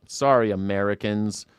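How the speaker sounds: ordinary talk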